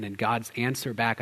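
usual speaking voice

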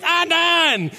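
put-on high pouty toddler; toddler pout. Preacher imitating niece refusing care. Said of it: "Penelope goes".

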